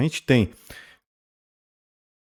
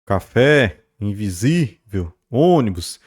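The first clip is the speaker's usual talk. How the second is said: "Café, invisível, ônibus" with FÉ, SÍ and Ô each held long; lengthened stressed syllables; slower than usual. Shown as a demonstration. Teacher recaps highlighted words for class, stretched stressed syllables. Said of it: "vão ter ali um alongamento na pronúncia".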